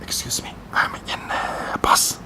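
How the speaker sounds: hushed phone whisper; whispered, apologetic phone excuse; mock whisper. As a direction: imitate someone whispering into a phone on a bus. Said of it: "It's like this".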